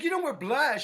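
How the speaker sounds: imitating a makeup artist berating a guest. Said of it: "she starts to berate her".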